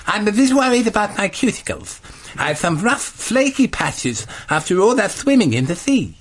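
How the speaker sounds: voicing John. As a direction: fret over rough cuticles. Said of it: "John says".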